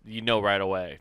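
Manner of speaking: normal talking voice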